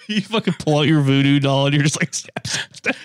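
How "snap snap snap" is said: unvoiced, much softer snap snap snap; whispered snaps. Mocking the lying friends with a voodoo doll, whispered snaps. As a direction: breathe the snaps out like a curse.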